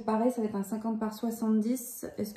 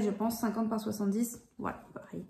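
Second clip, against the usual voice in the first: ordinary talk, nothing marked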